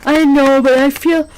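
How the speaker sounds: talking through a big yawn; stretched, yawning words, slowed down. Real, not acted. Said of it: "here I am yawning".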